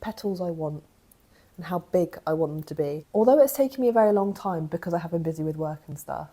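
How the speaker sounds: usual speaking voice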